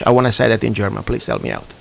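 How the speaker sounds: ordinary talk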